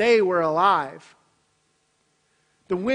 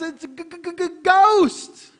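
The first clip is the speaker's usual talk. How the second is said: higher and louder, slow stammering g-g-ghost; mock terrified stutter; 8 semitones higher, 6 dB louder, much slower than usual. Preacher acting the disciples' cartoon-style ghost panic.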